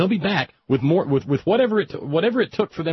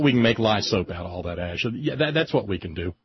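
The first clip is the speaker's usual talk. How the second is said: ordinary delivery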